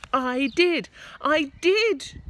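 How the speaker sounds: put-on boy insisting loudly to grandma; George shouting. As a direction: shout it up at her. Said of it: "George shouted".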